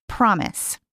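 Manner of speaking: "Promise." settled and falling; firm reply. A narrator's reading. Lise reassuring Mary, steady promise. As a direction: answer "Promise." calmly with a falling close. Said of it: "Promise." answer".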